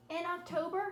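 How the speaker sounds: loud, emphatic month heading; announced month. A narrator's reading. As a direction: call out the month like a title.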